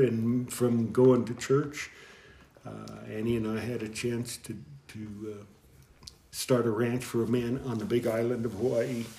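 ordinary talk, nothing marked

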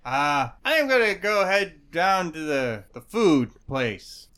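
tipsy gnome. Acted. Drunk gnome voice.

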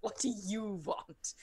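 slow, grumpy put-upon voice; grudging and slow; annoyed creature. Acted. Annoyed creature poking head out.

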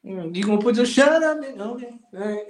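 normal talking voice

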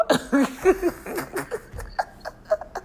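airy squealing cackle; long high unvoiced giggle; high breathy laugh. Real, not acted. Laughing at her own joke.

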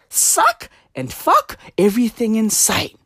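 normal talking voice

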